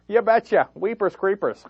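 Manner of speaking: mock folksy Palin-style accent. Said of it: "they have the same accent".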